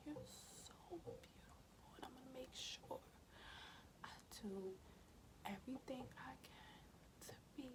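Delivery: soft, high, tearful whisper to her newborn. Real, not acted. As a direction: barely voice it through real tears. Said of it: "And I'm crying right now".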